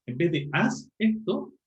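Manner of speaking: "haz esto" said slowly and clearly; slow contrast. Shown as a demonstration. Teacher contrasting haz and hace, slowly. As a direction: spell out "haz esto" for the class. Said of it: "con el verbo hacer".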